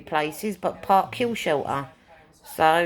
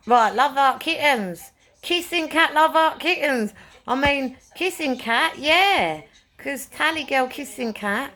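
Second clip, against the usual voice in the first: excited calling out; 8 semitones higher than usual. Real, not acted. Calling out pet names excitedly.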